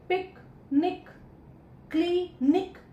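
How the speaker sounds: "पिक, निक, क्ली, निक" broken into syllables with clear pauses. Shown as a demonstration. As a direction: say each piece apart: "पिक", "निक", then "क्ली", "निक". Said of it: "break करने की जब कोशिश".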